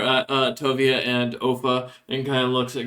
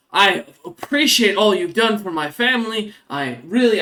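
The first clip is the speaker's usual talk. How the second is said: higher, louder fawning thanks; eager rat folk; 7 semitones higher, 4 dB louder than usual. Acted. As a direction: lay the gratitude on thick, a little high.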